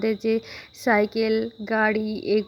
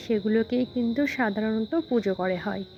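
ordinary delivery

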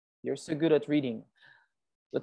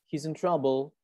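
normal talking voice

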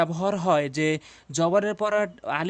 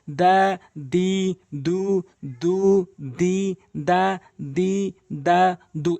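drawn-out vowels; much slower than usual. Shown as a demonstration. Teacher demonstrating long vowels to viewers, slow and stretched. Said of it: "বারবার উল্টো সিধে করে পড়বেন".

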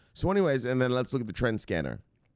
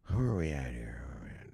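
barely audible whisper to oneself; hushed, private searching murmur; whispered self-talk; 4 semitones lower, 7 dB quieter than usual. Real, not acted. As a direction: whisper it absently while hunting for your place. Muttering while searching the screen.

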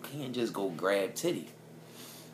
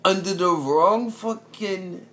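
high, loud, slow outrage; outraged shout; 9 semitones higher, 9 dB louder, slower than usual. Real, not acted. Telling a friend about the illegal hire, outraged loud shout. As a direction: shout it in disbelief.

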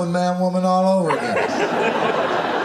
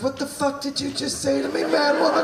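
mock outrage; 10 semitones higher than usual. Retold angry comeback.

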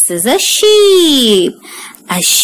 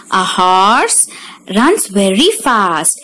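usual speaking voice

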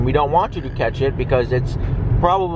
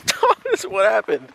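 high teasing voice; 7 semitones higher, slower than usual. Real, not acted. Laughing at fallen brother.

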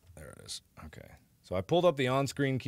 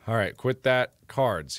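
normal talking voice

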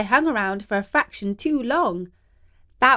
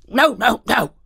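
lightly voiced dog, woofing no; a hint of a bark on each no; 6 dB louder than usual. A narrator's reading. Dog correcting the cat, a light woofing no. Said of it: "woofed the dog".

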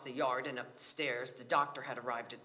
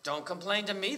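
higher, louder smirking retort; mildly smug brush-off; 6 semitones higher, 6 dB louder than usual. A narrator's reading. Bride's father turns away his son-in-law's complaint, smirking. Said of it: "the bride's father had smirked".

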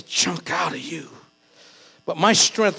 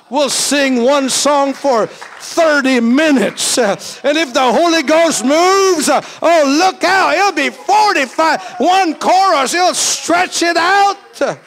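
higher, louder, stretched-out preaching; excited preaching; 9 semitones higher, 9 dB louder, slower than usual. Real, not acted. Pastor boasting to the church about long worship, fired up. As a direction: hype up the congregation about endless worship.

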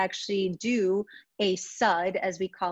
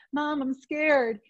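put-on slow, higher, small frightened child voice; scared kid; 6 semitones higher, much slower than usual. Retelling a frightened boy calling to his mom, slow scared child voice. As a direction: imitate a scared little boy, drawn out. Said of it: "the kid said".

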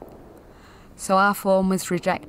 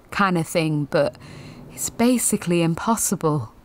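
ordinary talk, nothing marked

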